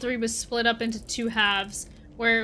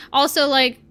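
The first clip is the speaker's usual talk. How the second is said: usual speaking voice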